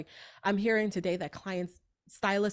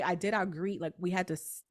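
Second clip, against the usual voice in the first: ordinary talk, nothing marked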